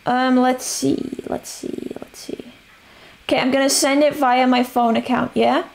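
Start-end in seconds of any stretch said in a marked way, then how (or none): 1.3-2.5 under the breath, whispering; whispered. Real, not acted. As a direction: trail off into a whisper. Thinking aloud while gaming.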